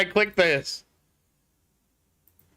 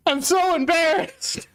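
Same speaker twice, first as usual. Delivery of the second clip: high, tearful whimper; embarrassed whimper; 8 semitones higher than usual. Real, not acted. Streamer to chat after a misclick, tearful and embarrassed. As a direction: whine it as if about to cry.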